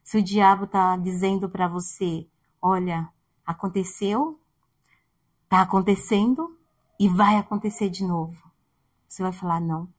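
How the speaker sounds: quiet earnest preaching; soft sermon. Acted. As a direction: bring the congregation close, gently.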